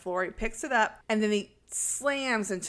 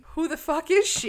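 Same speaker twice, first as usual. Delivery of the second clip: higher, louder incredulous question; mock disbelief; 8 semitones higher, 6 dB louder than usual. Retelling investigators learning of the impostor, mock disbelief. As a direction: blurt it in exaggerated bafflement. Acting out the investigators' shocked reaction.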